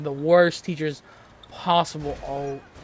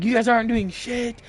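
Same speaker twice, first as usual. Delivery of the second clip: raised, higher-pitched mock scolding; imitated yelling; 8 semitones higher, 4 dB louder than usual. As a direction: mimic a kid yelling at teammates. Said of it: "yelling at us".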